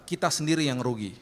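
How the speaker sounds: ordinary delivery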